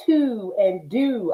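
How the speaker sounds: drawn-out Baltimore vowels on "to" and "do"; admiring imitation of Baltimore "to" and "do"; Baltimore accent. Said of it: "I like the way y'all say".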